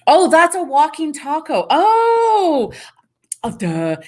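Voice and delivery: the penny drops, go way up; sudden realization. Real, not acted. Realizing what a viewer meant, high surprised oh.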